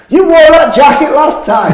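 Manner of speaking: high, loud heckle from the crowd; drawn-out, high-pitched shout at the stage. Retold or imitated. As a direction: yell it out from a few rows back. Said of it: "about four rows back shouts".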